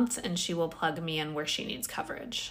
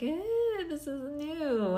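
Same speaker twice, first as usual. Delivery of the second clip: wary unsure; 9 semitones higher than usual. Retold or imitated. First day at a new job, voicing mild uncertainty. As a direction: sound doubtful about the plunge. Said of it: "I'm like".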